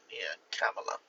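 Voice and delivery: ordinary talk, nothing marked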